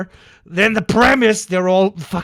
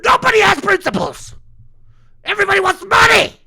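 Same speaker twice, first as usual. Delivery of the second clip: high, loud and fast furious yell; real angry shout; 14 semitones higher, 5 dB louder, faster than usual. Real, not acted. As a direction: blast it out in exasperation. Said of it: "Sorry, it was too much".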